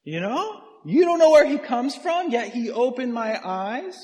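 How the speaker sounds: very high, drawn-out mock amazement; sticking it to them. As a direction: needle them with exaggerated, sing-out disbelief. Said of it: "sticking it to him".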